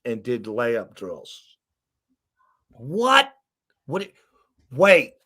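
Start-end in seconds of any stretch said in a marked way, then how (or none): 2.7-5.1 high, louder rising What; stunned what. Retold or imitated. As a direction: react in disbelief. Shock at layup drills.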